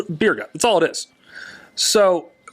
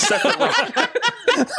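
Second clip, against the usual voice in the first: high, slow, laughing repeat; laughing disbelief; much slower than usual. Real, not acted. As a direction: let the words dissolve into laughter. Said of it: "realization punchline".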